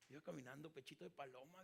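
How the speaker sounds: ordinary delivery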